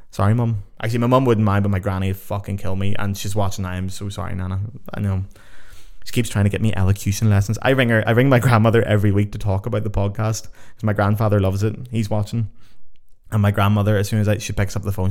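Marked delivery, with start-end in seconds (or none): none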